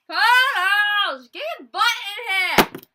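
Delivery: shouting for her to come in; calling out. Real, not acted. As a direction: yell across the house. Calling late friend in.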